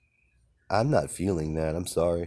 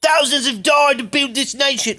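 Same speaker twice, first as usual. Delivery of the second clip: very high, very loud bellowing outrage; furious ranting shout; 9 dB louder than usual. Retold or imitated. Imitating an angry Australian man shouting at his country. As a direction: scream it like a man fed up with lies. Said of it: "He's mad as hell".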